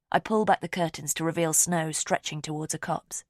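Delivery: ordinary delivery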